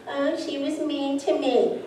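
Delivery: a hint of a hurt little girl. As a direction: whimper it lightly like a wounded child. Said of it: "That's my little girl".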